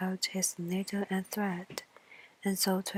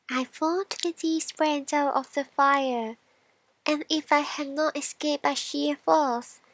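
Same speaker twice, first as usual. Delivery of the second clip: higher, louder coal voice; higher, louder voice of a frightened coal; coal character; 6 semitones higher, 4 dB louder than usual. Acted. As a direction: tell of the narrow escape as the coal. Coal voiced in fairy tale.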